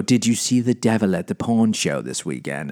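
a hint of a nosy church member asking; put-on voice. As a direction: ask it in a mild mock-gossip voice. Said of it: "I don't know why I'm talking like this".

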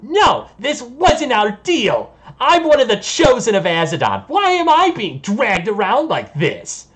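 high, loud protesting yell. Acted. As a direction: cry out in outrage as a captive being dragged. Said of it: "squirming around and yelling".

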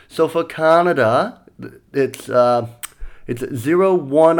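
put-on Australian accent, patient phone instructions; attempted Australian accent. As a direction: imitate a helpful Australian bloke explaining slowly. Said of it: "every time I try to do Australian".

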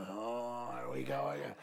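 low, slow weary groan; exasperated groan. Retold or imitated. Pastor imitating fed-up members, slow weary groan. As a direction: sigh it out, fed up. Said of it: "people are like".

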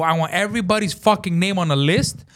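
high, forceful demand; retold screaming. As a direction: insist in outrage. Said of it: "as I'm screaming".

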